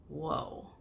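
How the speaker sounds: a soft, impressed whoa under the breath; quiet awed murmur. Acted. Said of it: "Lilith murmured".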